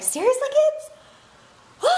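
high, slow exasperated "seriously"; drawn-out disbelief at the kids. Retold or imitated. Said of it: "you're like".

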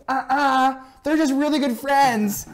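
very high imitated kid voice; insistent little child. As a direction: mimic a small child firmly contradicting, drawn out. Little child contradicts older sisters.

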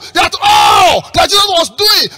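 impassioned high, loud sermon shout; full-force pulpit fervour; preach. Real, not acted. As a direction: climb to a shouted rhetorical question.

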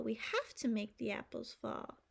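ordinary delivery

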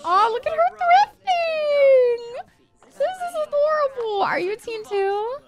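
high-pitched adoring squeal; obsessed gushing. Real, not acted. Player sees her Sim thrifting, high adoring squeal. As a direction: coo high over something adorable. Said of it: "I am obsessed".

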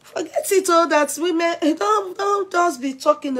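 high, loud, animated outburst; loud, high-pitched gossip exclamation; excited exclaiming. Real, not acted. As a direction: wave it all away at high pitch.

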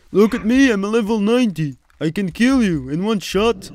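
high, loud, fast mock boast; mocking the bully. Gamer mimicking the high-level player who ganked him, loud mock boast. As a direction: sneer in a high taunting voice like a show-off. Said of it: "mocking the ganker's boast".

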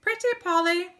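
high, loud parrot squawk; parrot call. A narrator's reading. Storyteller voicing other parrots for the children, high parrot call. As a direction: chirp it high like a talking parrot. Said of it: "Other parrots could say".

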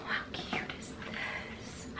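breathless whisper of delight; hushed thrilled whisper; excited whisper. Real, not acted. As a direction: whisper it like a secret treasure. Gasping over a pretty box.

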